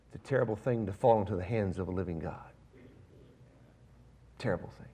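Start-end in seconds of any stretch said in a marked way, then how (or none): 4.4-4.9 whisper. Real, not acted. Echoing warning after a pause.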